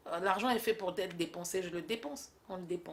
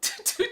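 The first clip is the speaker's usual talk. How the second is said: high burst of laughter; 13 semitones higher than usual. Acted. Bursts out laughing mid-reply.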